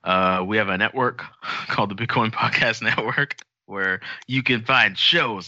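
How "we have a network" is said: normal talking voice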